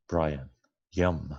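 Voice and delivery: plain reading voice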